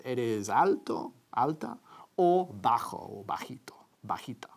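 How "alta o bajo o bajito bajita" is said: whisper. Real, not acted. Whispered aside in Spanish.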